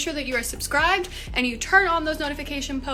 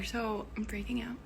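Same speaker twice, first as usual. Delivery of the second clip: whisper; 9 dB quieter than usual. Real, not acted. Startled by lights going off.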